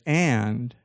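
deliberate, with a long pause after "And"; measured, spaced-out delivery; really slowly. Shown as a demonstration. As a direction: hang on "And", then deliver it carefully. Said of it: "I'll say it really slowly".

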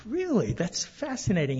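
a high, amazed "Really?" with mild interest after it; replaying his own astonishment, half-amused; surprised. Retold reply to the consul.

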